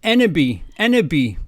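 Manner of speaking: loud mock-tragic cry of enemy; shouted sadly. Reading Draco's line aloud, a loud hammed-up wail. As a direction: wail it like a melodramatic teen boy. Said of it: "Shouted Draco sadly".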